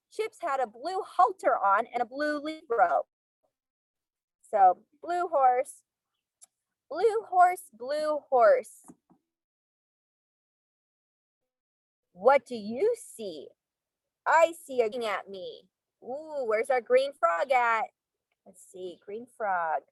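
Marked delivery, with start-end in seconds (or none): 4.5-15.6 sing-song storybook reading; reading to children. Acted. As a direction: read aloud to little kids. Reading picture book to class.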